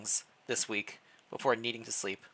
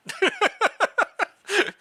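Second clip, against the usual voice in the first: loud, high giggle; high burst of laughter; 8 dB louder than usual. Real, not acted. Player laughing at own misread of the regent's name, giggling. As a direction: laugh off the slip.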